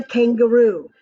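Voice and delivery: ordinary delivery, nothing marked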